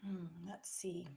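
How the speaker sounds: unvoiced murmur while reading; whispering to herself. Real, not acted. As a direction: whisper it under your breath.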